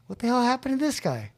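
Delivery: high-pitched incredulous reaction; baffled astonishment. Retold or imitated. Newcomer sees friend cleaned up, baffled and astonished.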